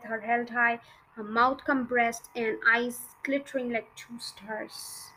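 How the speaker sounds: usual speaking voice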